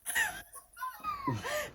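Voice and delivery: squealing high laugh of delight; high giggle. Real, not acted. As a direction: burst into a high, giddy laugh. Birthday vlog, overjoyed laughter.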